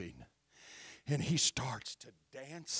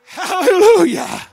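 shouted hallelujah; 23 dB louder than usual. Real, not acted. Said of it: "preacher's climax".

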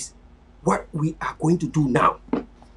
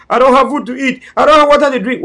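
higher, louder whiny mock complaint; mock complaining cry; 6 semitones higher, 6 dB louder than usual. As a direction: imitate people begging for help. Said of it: "We know we only cry".

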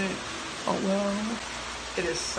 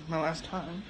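ordinary delivery

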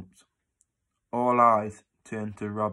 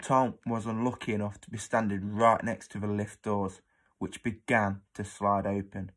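ordinary delivery

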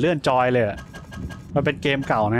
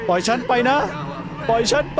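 panicked plea; 10 semitones higher than usual. Acted. Voicing a captive screaming at his captor, terrified. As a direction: beg to be let go in terror.

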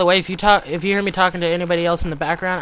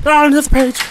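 high, loud startled cry; panicked yelp; 10 semitones higher, 10 dB louder than usual. Real, not acted. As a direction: blurt it and break into a shriek. Panicking in Slender game.

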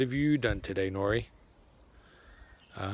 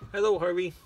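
talking to the dog; 7 semitones higher, 4 dB louder than usual. Real, not acted. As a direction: coo up at the dog. Greeting the dog.

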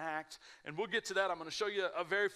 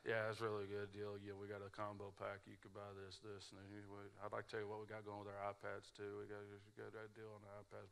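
low, much softer, fast mumble; mumbling salesman; 9 semitones lower, 13 dB quieter than usual. Retold or imitated. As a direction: barely open your mouth. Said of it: "this guy would not open his mouth".

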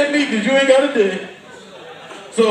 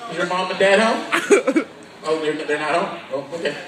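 lower, softer sneaky voice; mock sly predator; 5 semitones lower, 4 dB quieter, faster than usual. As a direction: creep in quietly checking for parents. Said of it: "be like".

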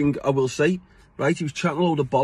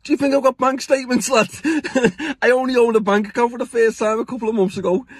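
laughing retort; 7 semitones higher than usual. Retold or imitated. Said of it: "And I think".

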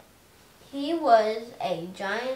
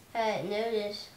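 plain reading voice

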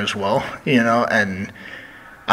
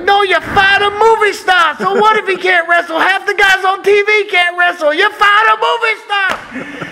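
Dusty ranting impression; 5 dB louder than usual. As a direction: protest firing a movie star in a hammed-up voice. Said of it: "He's like".

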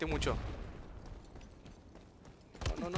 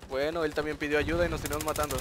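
normal talking voice

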